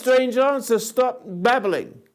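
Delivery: high, sharp and insistent; impatient demand. Real, not acted. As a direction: cut her off, demanding. Demanding, cutting her off.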